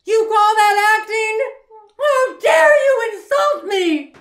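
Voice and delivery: high, loud offended shriek; high, loud hammed-up fury; indignant outburst. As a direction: explode in mock outrage. Offended outburst at extras actor.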